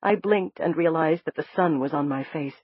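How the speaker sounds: usual speaking voice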